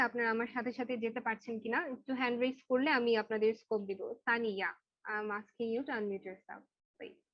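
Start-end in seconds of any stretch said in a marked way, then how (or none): none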